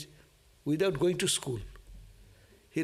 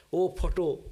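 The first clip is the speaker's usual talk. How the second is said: a hammed-up prayer to "photo", stretched "Oh" then "photo"; mock devotional cry. Teacher mocks a devotee praying to a photo, lightly sing-song. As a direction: mimic a devotee sighing "Oh, photo" to a picture. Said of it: "we don't go to worship".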